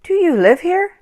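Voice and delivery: put-on high, curious boy's question. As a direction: ask shyly like a small boy. Boy asks the strangers.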